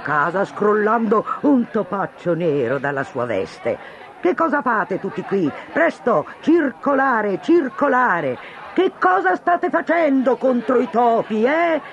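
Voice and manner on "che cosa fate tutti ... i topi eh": high, pressing questions; crowd demanding. Acted. Crowd badgers the mayor, high and demanding. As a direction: push the mayor for answers, much higher.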